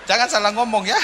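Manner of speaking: high, laughing warning. Real, not acted. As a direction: say it while laughing at the joke. Joke punchline with laughter.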